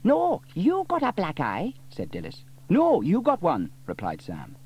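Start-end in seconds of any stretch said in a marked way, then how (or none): 0.0-1.7 high, loud busybody voice; Dilys insisting. A narrator's reading. Dilys argues. 2.7-3.7 high, loud fireman voice; Sam insisting. A narrator's reading. Sam argues back.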